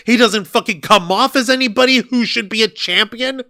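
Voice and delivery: ordinary talk, nothing marked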